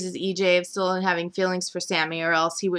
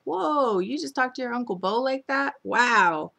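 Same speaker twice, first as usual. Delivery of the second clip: higher amazed whoa; impressed whoa; 5 semitones higher than usual. Real, not acted. Viewer impressed Carrie scolded Bo, higher. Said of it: "I was like".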